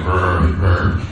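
low rumbling brr of a retreating dog; preacher imitating his dog's low growl; mock dog growl. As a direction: grumble like a scared little dog. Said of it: "still walking away going".

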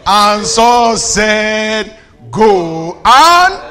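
very slow and loud, stretching each word; loud, suspenseful slow-motion proclamation; drawn-out dramatic reading. Acted. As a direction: draw every word out for the congregation. Preacher dramatises reading Saul's reply.

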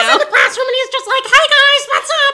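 very high, squeaky class-clown puppet; Nino. Acted. Puppet greets and sniffs the kids, squeaky and goofy. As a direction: talk in a silly squeal throughout. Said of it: "he kinda talks like this".

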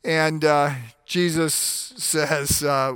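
ordinary talk

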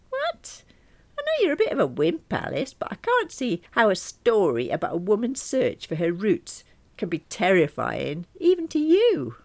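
high-pitched, exasperated disbelief; high, astonished, ending loud; incredulous friend. Acted. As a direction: scoff at a wimpy friend, high and animated. Friend incredulous at her fear.

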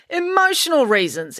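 put-on high, chin-up defiant girl. A narrator's reading. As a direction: say it like a dare, head held high. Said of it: "explained April defiantly".

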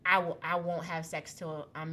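low, soft, subdued begging; quiet plea. Retold or imitated. Sleepless woman bargaining with God, softly. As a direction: promise it in a hushed voice.

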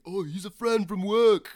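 high, loud and breezy; casual mock quote. Mocking how the movie's characters casually introduce a friend, high and loud. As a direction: toss it off like a casual buddy intro.

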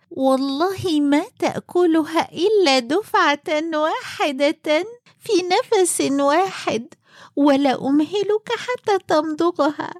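high, pushy woman's voice; insistent wife voice. Acted. Tailor's wife forcing the hunchback.

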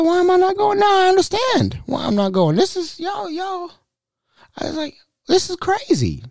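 very high, incredulous squeak; mock shocked realization. Hearing what happened at the party, mock shocked realization. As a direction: squeal it in stunned disbelief.

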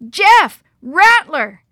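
high, very loud warning shouts; alarmed shouts. A narrator's reading. Boys warn the guide of a rattlesnake, shouting. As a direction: shout a sudden danger warning. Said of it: "shattered the stillness with shouts".

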